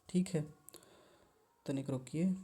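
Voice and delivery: ordinary delivery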